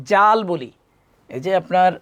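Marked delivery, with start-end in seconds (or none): 0.1-0.4 "জাল" with a hard j, short; no lisp on "জাল", just j; common mispronunciation. Shown as a demonstration. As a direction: demonstrate plain Bengali "জাল". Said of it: "অনেকে আমরা এভাবে বলি".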